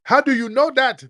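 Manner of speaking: high, loud sneering challenge; squeaky mock interrogator; mocking doubter. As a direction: ape a skeptic demanding proof.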